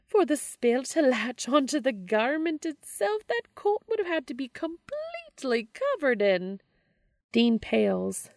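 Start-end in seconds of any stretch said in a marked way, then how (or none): 0.0-6.6 high crooning witch; sly old witch, pitched up. Acted. Witch continues explaining.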